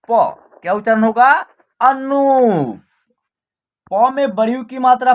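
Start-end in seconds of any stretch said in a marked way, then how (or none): 1.8-2.8 अनूप with नू stretched out; long vowel. Shown as a demonstration. Teacher giving the whole word, अनूप with long ऊ. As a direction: hold the ऊ in अनूप. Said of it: "आप long तरीके से उच्चारण".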